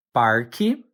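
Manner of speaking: "parque" with a sounded R, rising at the end; regional variant. Shown as a demonstration. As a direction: say "parque" as PAR-kee with a questioning lift. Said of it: "you might also hear it pronounced as".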